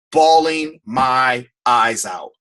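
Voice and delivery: stretched out and heavy; Bawling my eyes out, slow and drawn; slow emphatic repeat. Real, not acted. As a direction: drag each word out.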